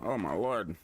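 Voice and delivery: normal talking voice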